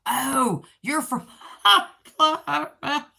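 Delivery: laughing through the words, pitch jumping up; giggling at her own mistake. Real, not acted. Laughing at mixing up the viewer's state.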